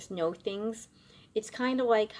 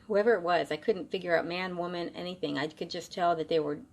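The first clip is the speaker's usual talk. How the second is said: ordinary delivery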